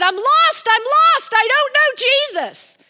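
high, loud, pleading wail; desperate cry. Retold or imitated. Person at the altar crying out to pastor, loud pleading. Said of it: "threw themselves down at the altar and said".

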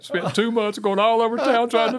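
hammed-up upset wife, building to a high-pitched cry; tearful wail. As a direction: wail it in one rising, hurt rush. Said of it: "she broke into tears".

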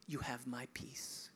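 soft whispered reassurance; hushed whisper. Acted. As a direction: whisper the promise of peace. Jesus' peace, hushed.

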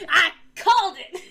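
laughing, high "I called it!"; triumphant, laughing gloat; gleeful gloating. Retold or imitated. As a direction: crow it while laughing. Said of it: "Tiro's like".

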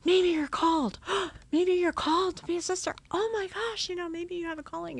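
high, thrilled, gushing friend; over the top excited. Retold or imitated. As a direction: bubble over with delight at the idea. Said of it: "she got super over the top excited".

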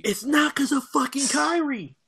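very high squealed denial; exasperated protest. Real, not acted. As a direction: protest in a squeak at being accused. Teased, protests the accusation.

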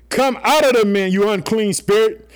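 high, loud command to cast out the spirit; commanding shout. Acted. Jesus commanding the unclean spirit, loud and high. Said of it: "For he was saying to him".

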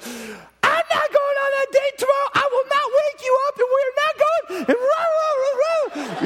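squeaky, very high mock tantrum; child freaking out. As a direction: imitate a six-year-old's furious whining rant. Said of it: "she starts freaking out".

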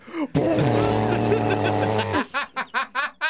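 long sputtering raspberry; mock bodily explosion; imitated bathroom noise. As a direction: blow out a long, comic splattering noise. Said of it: "and it's just like".

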